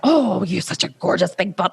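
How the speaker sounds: gushing like a proud mum; lightly cooing over her daughter; doting praise. Retold or imitated. Mother praising her daughter, a light doting coo. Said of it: "And I'm like".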